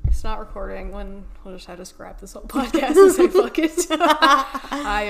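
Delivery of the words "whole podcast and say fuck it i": loud laugh, blurting the swear out. Real, not acted. Cohost laughing about scrapping the whole podcast, loud and giggly. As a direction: laugh it out and toss the line away.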